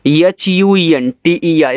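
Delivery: letter by letter, slow and clear; spelling aloud for students. Shown as a demonstration. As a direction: spell each letter slowly. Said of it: "इसको पढ़ते हैं Hunter".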